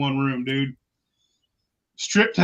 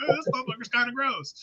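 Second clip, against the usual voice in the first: grossed out; 8 semitones higher, 5 dB quieter, faster than usual. Retold or imitated. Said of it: "I was like".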